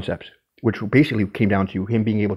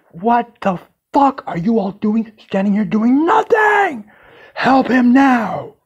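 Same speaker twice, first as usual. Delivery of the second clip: boss shouting; 13 semitones higher, 5 dB louder, much slower than usual. Retold or imitated. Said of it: "he turns to these guys and goes".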